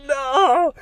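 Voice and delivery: high, loud cry of dismay; yelling no as she vanishes off the log; shocked cry. Retold or imitated. Husband cries out as his wife falls from the log, loud shocked no. Sees wife fell in river.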